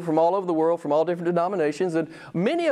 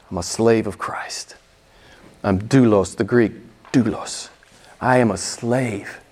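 low hushed Jude; 9 semitones lower than usual. Retold or imitated. Preacher voicing Jude's words to the congregation, low and hushed. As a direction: speak as a humble servant, low and hushed.

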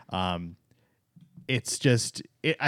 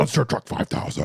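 monster truck ad; 3 semitones higher than usual. Pretend. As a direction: call it like an arena ad. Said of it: "You know the monster truck commercials?".

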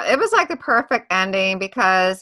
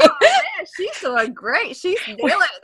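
mock admiration; 10 semitones higher than usual. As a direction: gush like an impressed onlooker. Retold admiration while watching her sing.

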